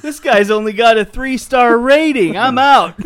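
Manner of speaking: high, loud comic bear. Pretend. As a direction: huff like a picky bear. Bear rating driver.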